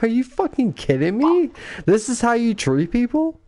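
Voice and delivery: high-pitched, indignant challenge; indignant disbelief. Retold or imitated. Recruiter scolding the bully who mistreats recruits, high-pitched. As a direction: call him out, appalled. Retold rant about bullying.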